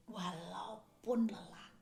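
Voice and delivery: slow whispered aside. Acted. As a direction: drop to a slow breathy hush. Tired shout urged softly.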